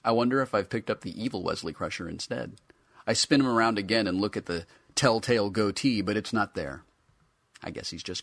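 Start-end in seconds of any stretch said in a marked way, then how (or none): none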